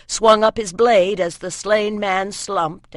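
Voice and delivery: ordinary delivery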